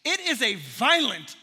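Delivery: normal talking voice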